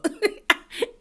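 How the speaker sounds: shrieking laugh, high and breathy; high shocked laugh. Real, not acted. Shocked reaction to Uba's remark.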